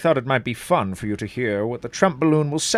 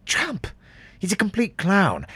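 appalled sigh of a question; shocked; 10 semitones higher than usual. Acted. MP in shock, very high dismayed fall.

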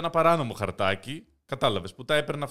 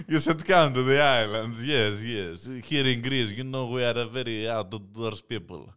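hammed-up Russian accent, selling the islands; 2 semitones lower than usual. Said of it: "Κυρίως άμα κάνεις ρώσικη προφορά όπως τώρα".

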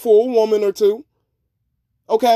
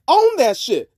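normal talking voice

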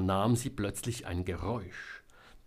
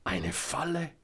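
doubting echo; 6 semitones higher than usual. A narrator's reading. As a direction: repeat it back questioningly, without shouting. Selma echoes him as question. (ungläubig fragend, hoch)

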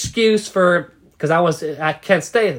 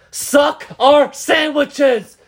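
put-on high, slow drunken bellow; slow drunk shout; drunken yell; 8 semitones higher, much slower than usual. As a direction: holler it like a drunk idiot. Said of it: "start screaming weird-ass shit".

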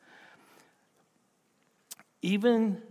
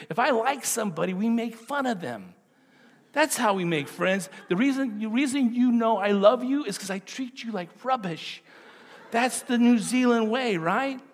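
higher, louder joking about Kiwi ways; lively teasing; 8 semitones higher, 7 dB louder than usual. Real, not acted. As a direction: tease the crowd about how Kiwis show love.

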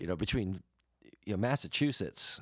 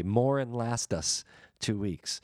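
slow, stretched-out country drawl; folksy drawl; 3 semitones higher, slower than usual. Shown as a demonstration. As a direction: draw each word out with a rural drawl.

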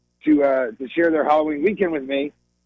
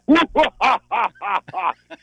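higher ooh then staggered ha-has; campy Christmas cackle; mock-spooky Santa laugh; 10 semitones higher than usual. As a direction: laugh like a hammed-up evil Santa. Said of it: "Almost sounds like Santa".

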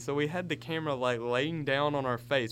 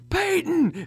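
high, loud yell of a name; mock panicked call into the woods; 13 semitones higher, 7 dB louder than usual. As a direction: shout for a missing friend. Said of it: "It sounds like".

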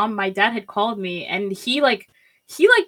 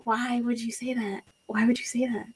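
softer, breathy whispered hurt question; 7 dB quieter than usual. Retold or imitated. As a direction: whisper it like the words stung. Retelling mom's hurtful remark, near tears.

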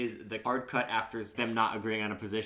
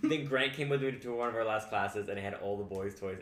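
usual speaking voice

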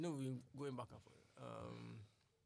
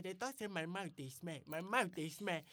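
high, louder Pidgin complaint; retold voice note; 5 semitones higher, 8 dB louder than usual. Retelling a funny voice note to a friend, mimicked complaint. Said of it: "I can remember this voice note".

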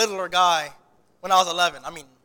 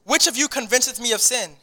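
raised, solemn Bible reading; scripture reading; 3 semitones higher, 5 dB louder than usual. Acted. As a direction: read the verses out with weight. Reads scripture aloud.

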